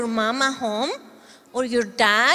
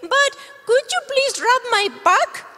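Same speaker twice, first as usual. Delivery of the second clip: high, bright little dog voice; puppy puppet; 11 semitones higher, 3 dB louder than usual. Acted. Puppet dog greeting the kids, much higher and cheerful. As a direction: play the eager puppy.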